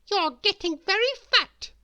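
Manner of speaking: high, loud, prodding bossy lady; shrill-edged know-it-all scold. A narrator's reading. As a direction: boss him about from way up high. Miss Bossy voiced in story.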